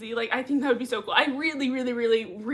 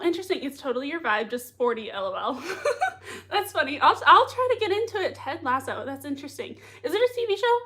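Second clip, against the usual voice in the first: ordinary delivery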